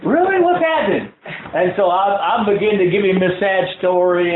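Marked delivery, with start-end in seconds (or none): 0.1-1.1 much higher, startled question; surprised friend. Retold or imitated. Friend on the phone reacting, surprised and high. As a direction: perk right up at the news.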